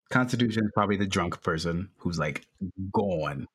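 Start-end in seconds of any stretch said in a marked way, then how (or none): none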